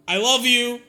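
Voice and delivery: high, loud desperate I love you; high pleading confession. Acted. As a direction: cry it after her as she leaves. Pleads again as she leaves.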